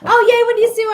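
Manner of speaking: bright, high, loud hello to a viewer; light up as a friend walks in; excited greeting. Real, not acted. Reader greeting a returning viewer joining her stream, excited and loud.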